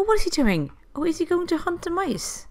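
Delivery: ordinary talk, nothing marked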